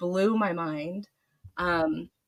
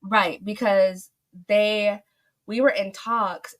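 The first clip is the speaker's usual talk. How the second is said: normal talking voice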